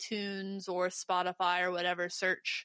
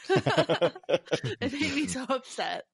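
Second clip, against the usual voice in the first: laughing; 4 semitones higher, 4 dB louder, much slower than usual. Real, not acted. Laughing at an ugly amp.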